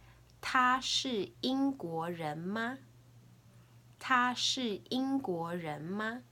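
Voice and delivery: slow question model. Shown as a demonstration. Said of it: "I'll say it three times".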